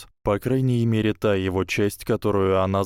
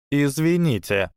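polite but firm; 6 semitones higher than usual. A narrator's reading. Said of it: "вежливо, но твёрдо произнесла Ханна".

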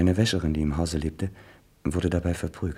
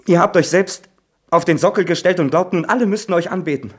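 higher and louder, shouting in rage; furious outburst; 6 semitones higher, 5 dB louder than usual. Acted. Son shouting furiously at his mother about her lover. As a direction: hurl every accusation at her.

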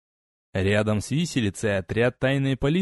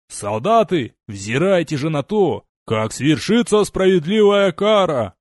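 loud, higher public sentencing; booming proclamation; 4 semitones higher, 5 dB louder than usual. Acted. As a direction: proclaim the verdict to the troops. Legate proclaims sentence to regiment.

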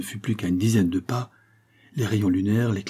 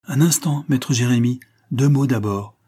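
louder, higher sudden call; abrupt exclamation; 3 semitones higher, 5 dB louder than usual. Acted. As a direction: stop him sharply from the shadows. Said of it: "s'écria brusquement le verrier".

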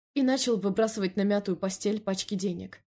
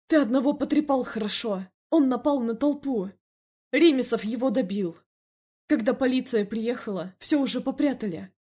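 higher, louder, worked-up character voice; agitated Felix figure; 4 semitones higher, 4 dB louder than usual. Acted. Telling Эмиль about the shootout, agitated. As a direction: report the trouble in an agitated, raised voice.

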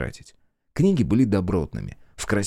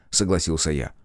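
plain reading voice

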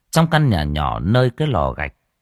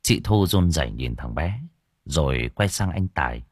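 plain reading voice

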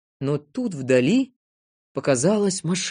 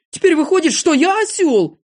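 wounded, pitched-up outcry; hurt protest; 11 semitones higher, 6 dB louder than usual. A narrator's reading. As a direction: complain indignantly at the insult. Незнайка protests being called donkey.

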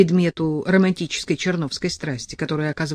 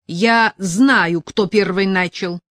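louder, cutting him off; curt retort; 3 semitones higher, 7 dB louder, slower than usual. A narrator's reading. Sasha cutting Petka off, loud and curt. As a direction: cut him dead. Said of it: "отрезала Саша".

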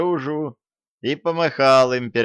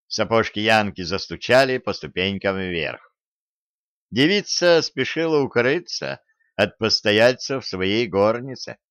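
ordinary delivery

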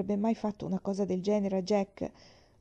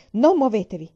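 firm order; 8 dB louder than usual. A narrator's reading. Mother ordering girls under blanket.

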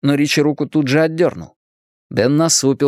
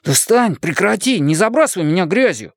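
higher-pitched, mild yell of protest; annoyed boy's raised voice; protesting cry; 7 semitones higher than usual. A narrator's reading. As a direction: cry out for him to quit it. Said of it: "закричал Эдди".

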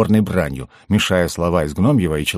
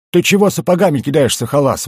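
high, loud outrage; affronted, high-pitched complaint; indignant protest; 9 semitones higher, 5 dB louder than usual. A narrator's reading. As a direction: protest sharply at a thrown boot. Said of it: "возмутился Шут".

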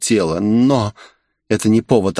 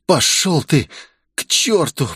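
ordinary delivery

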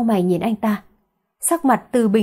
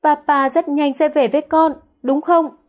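put-on high, pleading little-girl voice; bé gái khàn khàn; 5 semitones higher than usual. A narrator's reading. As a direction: ask papa for a promise, as a tearful child. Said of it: "khàn khàn nói". (giọng bé gái cao, van nài)

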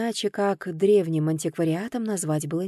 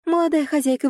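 high, louder eager chatter, stress on молодая; chirping maids; 7 semitones higher, 5 dB louder, faster than usual. A narrator's reading. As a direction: gush over her like a flock of girls. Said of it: "защебетали".

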